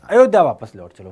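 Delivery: loud, resolute plea; determined queen's appeal; firm voice. Acted. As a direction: say it firmly, with added volume. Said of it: "Kaikeyi के मुख से दृढ़ स्वर में कही गई".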